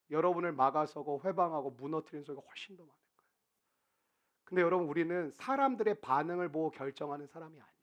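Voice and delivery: ordinary talk, nothing marked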